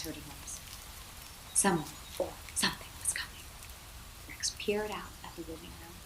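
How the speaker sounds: hushed story whisper; suspense whisper. A narrator's reading. Narrator reading a spooky moment to a child, whispered.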